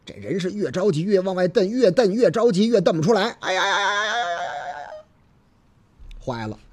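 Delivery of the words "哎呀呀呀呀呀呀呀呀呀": 急得直叫. Acted. 姑娘手拔不出来.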